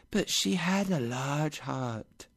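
slow, high, soft ghostly voice; gentle spirit. Acted. Spirit praises Scrooge's sister, soft and slow. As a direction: float it out softly and slowly.

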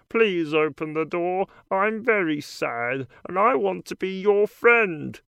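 lightly voiced frog character; a hint of a croaky frog. A narrator's reading. Frog pleading at door.

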